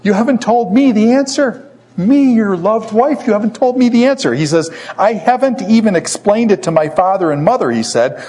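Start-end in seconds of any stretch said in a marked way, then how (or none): 0.0-4.3 high-pitched wounded wife's plea; hammed-up indignant whine; mock crying. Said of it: "She's crying". 5.0-7.7 raised-pitch defensive protest; lightly acted exasperated husband; mock indignant retort. Samson retorts to crying wife.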